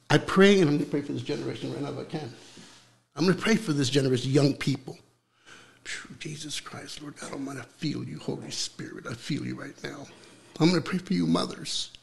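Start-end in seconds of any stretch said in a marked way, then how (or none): 5.8-10.1 much softer, reverent prayer; a preacher's hushed, reverent prayer to the Holy Spirit. Real, not acted. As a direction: pray softly, feeling the Spirit near. Begins praying in the Spirit.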